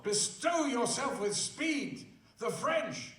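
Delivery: high, slow and grand, declaiming like a king on stage; mock Shakespearean command. Actor quoting Henry rallying his army, slow and grand.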